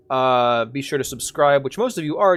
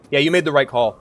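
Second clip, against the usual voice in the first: ordinary talk, nothing marked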